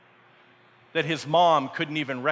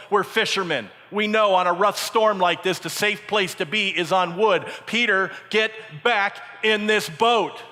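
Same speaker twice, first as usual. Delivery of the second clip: stern order; 5 semitones higher, 4 dB louder than usual. Acted. Acts fishermen ordering Peter back.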